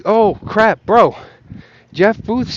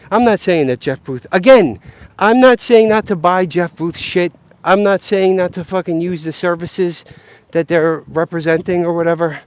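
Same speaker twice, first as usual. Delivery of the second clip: ordinary delivery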